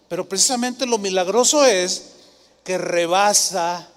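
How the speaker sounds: usual speaking voice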